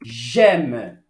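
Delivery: "j'aime" said low and clear as zhem; French model word. Shown as a demonstration. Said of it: "Então".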